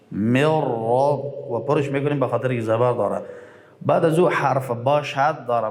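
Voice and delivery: ordinary talk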